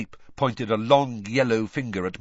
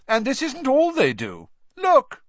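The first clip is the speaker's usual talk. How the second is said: high, loud, gloating schoolboy; loud jeer; 6 dB louder than usual. A narrator's reading. Malfoy showing off his badge to Harry, high loud gloat. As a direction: show it off to the crowd, loudly.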